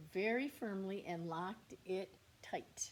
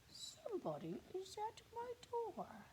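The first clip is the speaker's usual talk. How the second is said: Bear's voice; 13 semitones higher, 6 dB quieter than usual. Acted. Bear wakes to a tap.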